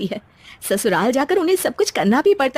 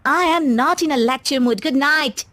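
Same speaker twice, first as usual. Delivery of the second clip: slow, dismissive English; bored spoilt bride; 4 semitones higher, much slower than usual. Acted. Bride brushing off her husband's lecture, slow and bored. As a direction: wave him off and go to bed.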